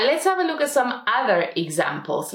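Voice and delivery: usual speaking voice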